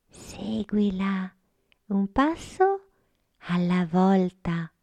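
slow whisper, one step at a time; whispered guidance. Acted. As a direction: breathe the words like a secret. Sofia guiding Gorgotto gently.

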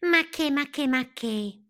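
high, rattled-off brush-off; dismissive lively caterpillar. A narrator's reading. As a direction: wave the idea away in a high little voice. Lively Palmira dismisses the idea.

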